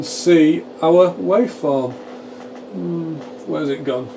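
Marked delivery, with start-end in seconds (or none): none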